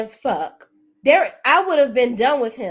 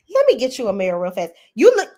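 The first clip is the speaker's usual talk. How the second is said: ordinary delivery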